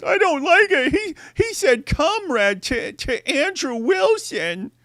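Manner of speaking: high, slow, fake-crying whine; imitating crybaby fans, slow weepy wail; mock crybaby whine. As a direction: blubber it like a big baby, pitch up. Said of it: "You guys are such babies".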